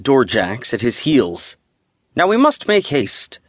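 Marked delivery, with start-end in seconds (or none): 2.2-3.4 much higher, fussy commanding voice. A narrator's reading. Steward ordering his doorjacks to hurry, much higher and commanding. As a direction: rush the doorjacks along from on high. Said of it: "he commanded".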